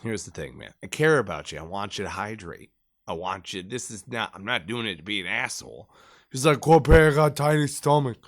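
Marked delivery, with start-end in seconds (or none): 0.0-5.9 low, soft retold pep talk; level with him quietly and sincerely; calm reassurance. Retold talking-down of sick gunner. 6.6-8.2 put-on Spanglish accent. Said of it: "still probably has that fucking accent".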